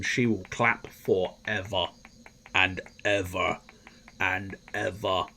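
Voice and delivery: slow, measured repetition with long pauses; mock-solemn, slowly fading; drawn-out solemnity. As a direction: draw out each phrase endlessly.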